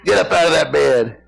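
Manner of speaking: lightly voiced bossy wife; mock scolding order; mean wife. Jezebel rousing sulking Ahab from bed, mock mean. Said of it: "She's a mean old woman".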